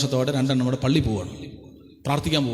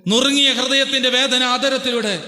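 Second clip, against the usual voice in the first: ഉയർന്ന സ്വരത്തിൽ ആവേശത്തോടെ പ്രസംഗം; impassioned preaching; 8 semitones higher than usual. Acted. Preaching at full force.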